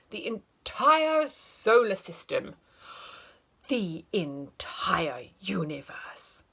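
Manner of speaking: teacher ranting about egg sandwich; escalating rant. Acted. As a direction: build the outrage wider.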